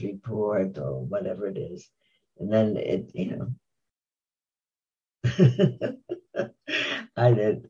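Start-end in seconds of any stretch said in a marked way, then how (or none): 5.2-6.5 spontaneous laugh to oneself. Real, not acted. Speaker laughing mid-talk before explaining why, real laugh. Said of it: "I'm laughing".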